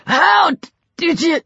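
high, strained, broken attempt to speak; choking victim. A narrator's reading. Lang, pinned by Peter, asks how, choking. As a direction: struggle the words out in bursts.

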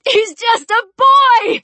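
high, slow, loud wail; drawn-out desperate shout; anguished cry. Acted. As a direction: cry out for the boy's life. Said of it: "she cried".

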